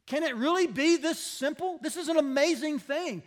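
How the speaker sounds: high, loud preaching appeal; impassioned plea. Real, not acted. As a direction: press the point hard, high and loud. Preacher pleads, building emphasis.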